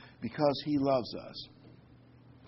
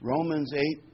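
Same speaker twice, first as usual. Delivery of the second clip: ordinary talk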